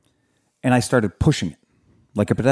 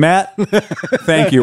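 laughing thanks; 4 semitones higher, much slower than usual. Real, not acted. Reacting to listener message.

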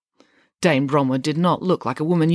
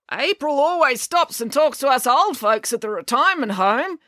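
put-on old man voice, pitched up; raised, high old-timer's voice; octogenarian calling out; 9 semitones higher, 3 dB louder than usual. A narrator's reading. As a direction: call out as an elderly man in a crowd. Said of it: "an octogenarian man called out".

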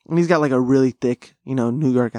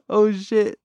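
high surprised oh shit; 8 semitones higher than usual. Retold or imitated. Repeats his reaction.

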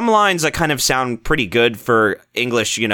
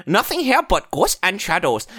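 higher, hammed-up Chinese accent; mock Chinese accent; 4 semitones higher than usual. Mark in the booth saying the line, mock Chinese accent. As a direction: read the line in a badly faked Chinese accent. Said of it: "in your Chinese accent".

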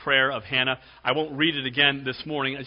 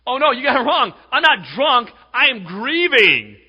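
loud, raised-pitch indignant denial; acted-out cry of being wronged; emphatic protest; 10 semitones higher, 13 dB louder than usual. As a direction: protest loudly, stretching out the last words. Acts out Hannah protesting to Eli.

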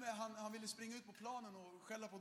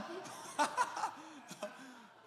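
laugh; 6 semitones higher, 9 dB louder than usual. Real, not acted. Laughing at own joke.

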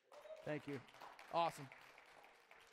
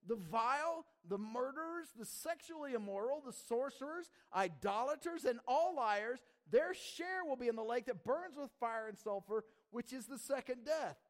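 raised, emphatic preacher's delivery; forceful scripture reading; 5 semitones higher, 4 dB louder than usual. Real, not acted. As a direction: hammer out each item of the grim list. Reads scripture at full force.

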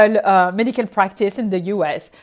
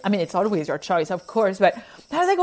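ordinary delivery

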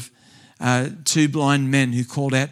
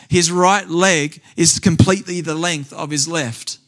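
rising, louder preacher build; fervent sermon storytelling, building; preaching climax; 3 semitones higher, 3 dB louder than usual. Acted. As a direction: drive the testimony up to its peak.

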